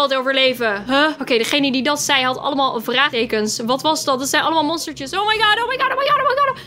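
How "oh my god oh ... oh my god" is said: much higher, rapid panicked repeats; panicked oh my god. Real, not acted. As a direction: freak out as monsters swarm. Swarmed by little monsters. (paniekerig gillen)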